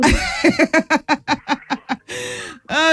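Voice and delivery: long, high, delighted giggle; high laugh. Real, not acted. Host amused that the caller takes it back, high laugh. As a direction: burst into a run of high laughter.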